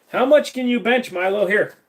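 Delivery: "How much can you bench, Milo?" lifted high and bouncy to the dog; playful pet talk. Acted.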